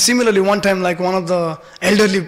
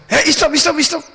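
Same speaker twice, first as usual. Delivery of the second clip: urgent high-pitched halt; Krishna; 9 semitones higher than usual. Retold or imitated. Little Krishna stopping the gopi, urgently. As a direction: cut in quickly to halt someone, pitched high. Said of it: "And Krishna stops her".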